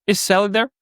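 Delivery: put-on nervous kid calling a girl; nervous teen caller. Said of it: "I'm like".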